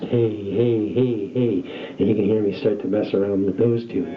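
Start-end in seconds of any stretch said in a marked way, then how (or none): 0.1-2.0 phased voice. Shown as a demonstration. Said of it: "I can phase adjust".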